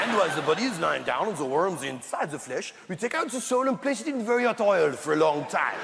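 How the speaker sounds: hammed-up French accent; thick comic French accent. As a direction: describe hell like a French chef. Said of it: "in a French accent, for instance".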